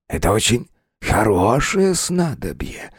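coaxing, ingratiating spirit voice; silky, charming voice. Acted. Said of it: "вкрадчивый, чарующий голос".

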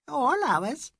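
a bright high little answer; high Indian lady. A narrator's reading. Mrs Bhattacharya to Mrs Moore, high and bright.

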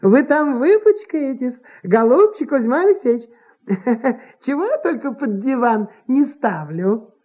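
playful concern in a woman's character voice; sympathetic. A narrator's reading. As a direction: fuss kindly over him, amused. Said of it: "посочувствовала".

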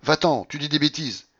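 high, irritated dismissal; losing patience. A narrator's reading. Babouchka to de Grier giving advice, losing patience. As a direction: brush him off crossly. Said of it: "La babouchka perdit patience".

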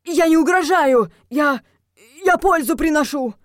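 high, loud pleading; squealed, flustered protest; panicked shrieking. A narrator's reading. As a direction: defend yourself desperately, stumbling. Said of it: "взвизгнул призрак".

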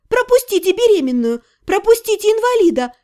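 high-pitched call to clear the way. A narrator's reading. Heroine pushing through a crowd of students, high calling out. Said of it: "для ускорения процесса кричала".

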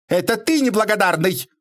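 very high indignant cry; shrill retort. A narrator's reading. Lady echoing Bobrov's accusation back at him, shrill retort. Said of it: "вторила ему Геля".

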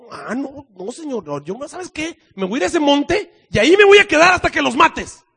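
high-pitched defiant refusal, bursting loud at the end; stubborn Jonah. Acted. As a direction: refuse God like a sulking child. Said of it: "le dijo al Señor".

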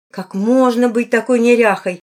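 loud, exasperated scolding. A narrator's reading. A woman combing out the girl's tangles, scolding. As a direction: tell off a messy child. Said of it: "ругалась она".

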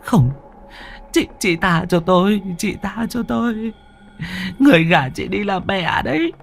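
high, frantic pleading; a girl shrieking pleas at a ghost; terrified begging. A narrator's reading. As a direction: beg the ghost for mercy.

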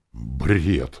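plain reading voice

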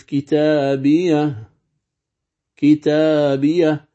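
كتابية said very slowly, ending on a still silent ha; pausing on the ha. Shown as a demonstration. Teacher slowly reciting كتابية to show the pause. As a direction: stretch out كتابية and stop on its final ha. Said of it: "كأنني ساقف".

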